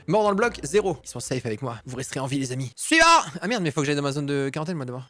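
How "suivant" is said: loud, high call of next. Acted. Calls next person in line.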